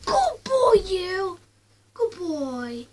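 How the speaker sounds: put-on high, loud gushing good boy; excited praise. Shown as a demonstration. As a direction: gush over the dog, loud and high.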